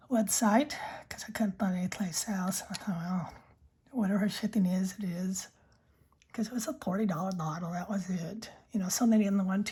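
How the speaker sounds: unvoiced ASMR whisper; soft whisper. Real, not acted. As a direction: breathe the words close to the mic. Whispered ASMR pen chat.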